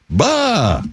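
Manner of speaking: high, drawn-out scoffing "bah"; exasperated bah. Real, not acted. Radio host grumbling at September flying by, exasperated. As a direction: toss out a high, dismissive bah. Grumbling about how fast time flies.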